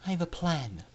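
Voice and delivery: soft secret murmur; whispered. A narrator's reading. Scootaloo to Aurora with guards near, soft whisper. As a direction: whisper it close, conspiratorial. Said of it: "she whispered to Aurora".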